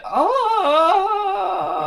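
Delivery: very high mock yell of Gary Neville; commentator scream. Wishing for Gary Neville's yell on a last-minute winner, very high mock shriek. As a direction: shriek it like a thrilled pundit. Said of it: "just hear".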